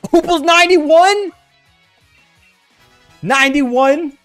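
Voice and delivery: high, loud disbelief; can't believe the number; surprised. Real, not acted. Surprised reading a question.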